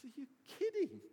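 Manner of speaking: high-pitched, acted disbelief; incredulous protest. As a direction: play Peter, stung and disbelieving. Peter protests Jesus's prediction.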